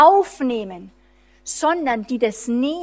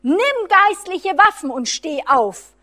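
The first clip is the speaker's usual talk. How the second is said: higher, louder commanding call; God's calling voice; 5 semitones higher, 7 dB louder than usual. Retold or imitated. Voicing God calling his son to rise up: loud, higher commanding call. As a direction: ring out like a summons from heaven. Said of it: "dass Gottes Ruf".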